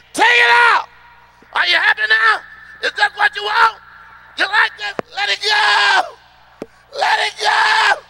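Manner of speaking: high, loud furious yelling; hammed-up furious wife screaming; angry shouting woman. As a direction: blow up at him in a rage.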